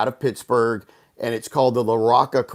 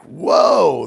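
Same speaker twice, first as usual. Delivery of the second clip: loud, raised whoa; stunned, pitched-up outburst; astonished reaction; 5 semitones higher, 8 dB louder than usual. Retold or imitated. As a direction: react to a startling revelation. Retells his reaction to revelation.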